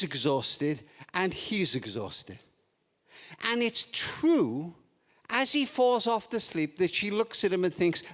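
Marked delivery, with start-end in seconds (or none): none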